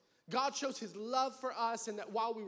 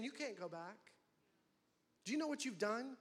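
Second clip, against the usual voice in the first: lower, much softer accusing voice; voice of the enemy; 3 semitones lower, 9 dB quieter than usual. Acted. The enemy taunting the sinner that there is no way back. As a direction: hiss it like a condemning whisper. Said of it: "And the enemy is saying".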